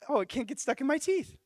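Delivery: high-pitched wonder; thrilled, high acted reaction; amazed realization. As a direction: squeal it as a sudden revelation. Retold amazed reaction to smoothie.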